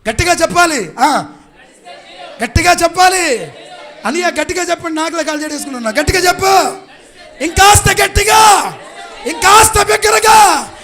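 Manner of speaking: very high, very loud preacher's shout; full-force rallying preaching. Real, not acted. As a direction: whip the crowd up, building to stretched shouts.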